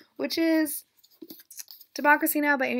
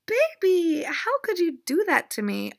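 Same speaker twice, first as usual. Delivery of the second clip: scolding the cat; 8 semitones higher, 6 dB louder than usual. Real, not acted.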